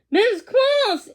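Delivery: high, loud cry of her name; excited exclaim. A narrator's reading. Eli spotting Missus Claus at the window, high excited call. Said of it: "Eli exclaimed".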